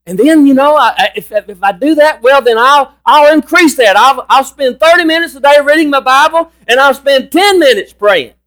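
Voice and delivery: high-pitched, stumbling mock enthusiasm; imagined eager churchgoer. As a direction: act out someone getting carried away with their own plan. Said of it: "I can just hear somebody thinking".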